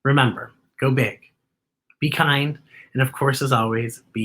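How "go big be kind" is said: ordinary delivery, nothing marked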